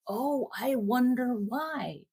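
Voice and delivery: slow, high mock wonder; sarcastic. Real, not acted. Speaker mocking the parents, slow and sarcastic. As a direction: drag it out with irony.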